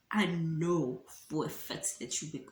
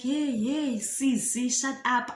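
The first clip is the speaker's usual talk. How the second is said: high, louder mock yell; mild mock yell at the boyfriend; retold scream; 5 semitones higher, 4 dB louder than usual. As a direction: throw out a half-hearted shout. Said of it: "the girl is screaming like".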